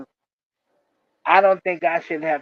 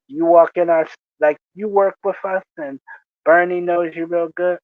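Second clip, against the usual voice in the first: normal talking voice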